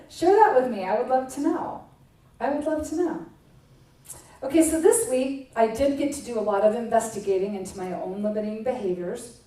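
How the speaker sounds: ordinary talk, nothing marked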